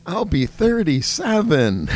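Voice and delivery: proud mock child announcing her age; imitating a little girl. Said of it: "She goes".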